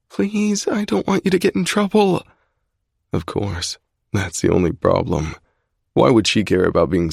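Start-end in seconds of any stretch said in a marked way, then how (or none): 0.1-2.2 high female voice, pleading; girlfriend pleading. A narrator's reading. Alex begging Zai to stop, high female plea. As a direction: beg him to stop in her higher voice.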